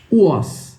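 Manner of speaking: WAS said "u-ÓSS", open O; learner's WAS, rhyming with "boss"; Brazilian mispronunciation. Retold or imitated. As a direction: end WAS on an S instead of Z. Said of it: "Pronuncia ela assim".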